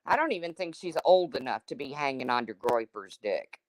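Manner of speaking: ordinary talk, nothing marked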